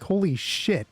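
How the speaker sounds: normal talking voice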